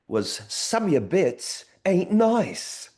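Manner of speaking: high, very slow put-on British accent. Recalling the British school video title in a put-on British accent. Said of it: "because the accent you did is her accent".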